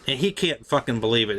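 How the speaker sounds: ordinary delivery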